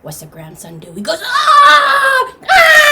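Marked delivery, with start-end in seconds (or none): 1.2-2.9 high, loud held tantrum scream; long sustained scream. Acted. Grandson refused the treasure box, one long screamed tantrum. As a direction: scream on and on like a thwarted child. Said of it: "screaming one long, sustained scream".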